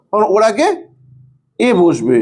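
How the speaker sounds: usual speaking voice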